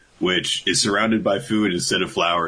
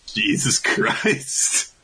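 usual speaking voice

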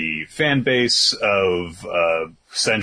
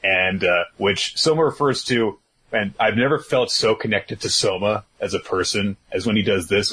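ordinary delivery

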